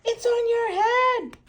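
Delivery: high, slow, laughing squeal. Acted. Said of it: "Peppa laughs".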